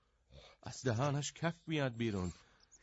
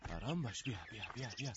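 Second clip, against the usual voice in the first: soothing; 3 semitones lower, 8 dB quieter, faster than usual. Acted. Soothing horse softly.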